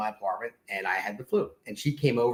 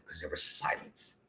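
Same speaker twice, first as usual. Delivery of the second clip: whispered, dropping to nothing; hushed punchline; 8 semitones lower, 12 dB quieter than usual. Acted. Husband landing his joke in a whisper. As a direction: let the punchline fall into a whisper.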